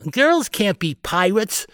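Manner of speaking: high, slow, put-on kid's taunt; scornful boy. A narrator's reading. Kevin frowning at Carley, scornful. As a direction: lay down the rule scornfully, drawn out.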